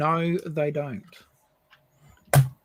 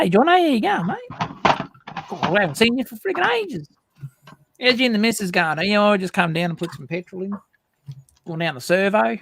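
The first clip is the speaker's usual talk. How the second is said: put-on Aussie accent; 4 semitones higher than usual. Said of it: "Seems to be some weirdo on the call".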